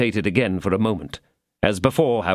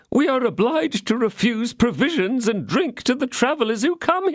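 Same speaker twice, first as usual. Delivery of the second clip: innkeeper; 12 semitones higher than usual. A narrator's reading. Innkeeper laments to D'Artagnan.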